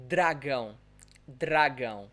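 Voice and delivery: "dragão" with a tapped Portuguese R; vibrating R. Shown as a demonstration. Teacher repeating the correct word for students, vibrating R. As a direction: flick the R in "dragão". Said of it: "We pronounce it".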